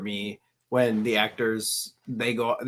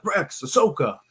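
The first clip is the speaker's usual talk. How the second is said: lightly imitated young hero's voice, pitched higher; Anakin voice; 6 semitones higher than usual. Fan retelling how the actor greeted them, a light imitation of the hero's voice. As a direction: call the names like a young Jedi captain, a quick retold impression. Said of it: "in his Anakin voice".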